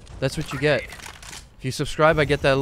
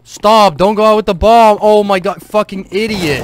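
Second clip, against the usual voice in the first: higher, louder furious yell; angry shouting; 8 semitones higher, 9 dB louder than usual. Real, not acted. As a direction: yell at him to stop. Teammate runs off with bomb.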